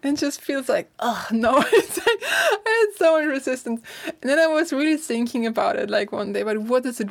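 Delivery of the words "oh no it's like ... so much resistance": laughing cringe. Real, not acted. Laughing at her own resistance.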